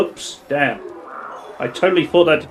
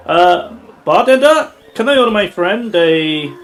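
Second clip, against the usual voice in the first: calling out; 6 semitones higher, 7 dB louder than usual. Acted. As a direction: call across the bar. Calling to the bartender.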